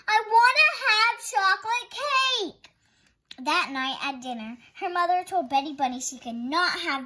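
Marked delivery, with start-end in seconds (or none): 0.1-2.7 high, slow whiny demand; protesting child. Acted. Betty demands cake from her mother, high whine. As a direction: insist like a stubborn child. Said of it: "Betty Bunny protested".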